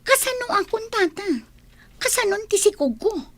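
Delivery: high, softened pleading; worried pleading. Acted. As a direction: plead upward in a small, troubled voice. Pleads with uncle about her fate. (agpakpakaasi a madanagan)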